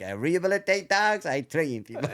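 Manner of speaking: high, put-on Mexican accent; Cesar Millan. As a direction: exaggerate the Mexican accent as an impression. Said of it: "he'd say his little thing".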